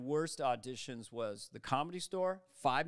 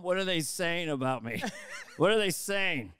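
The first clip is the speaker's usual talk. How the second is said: raised, insistent, hammed-up paranoia; paranoid caller; 4 dB louder than usual. Actor calling co-star at night at home, paranoid demand. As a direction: demand it louder the second time.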